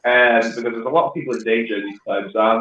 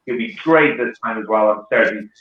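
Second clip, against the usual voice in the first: usual speaking voice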